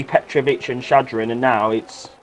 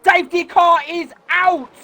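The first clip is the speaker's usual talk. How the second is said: motorsport commentator. Real, not acted. Live call of safety car.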